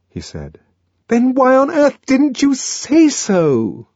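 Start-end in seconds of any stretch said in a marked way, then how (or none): 1.1-3.9 high, slow, loud exasperation; exasperated cry. A narrator's reading. Lenina crying out at John, exasperated. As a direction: demand the answer in frustration. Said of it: "she cried".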